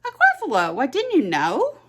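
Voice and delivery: a tiny mouse feigning surprise; squeaky mouse. Acted. Mouse to the fox, a high surprised squeak.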